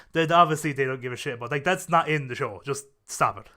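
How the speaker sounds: normal talking voice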